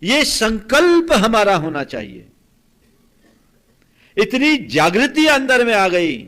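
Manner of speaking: loud, high, stretched-out exhortation; full-force preaching. Acted. Preacher urging listeners to make a resolve, forceful. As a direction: proclaim it slowly and loudly to the congregation.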